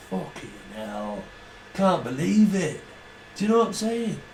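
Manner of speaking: lightly voiced Mancunian rock star moaning about his brother.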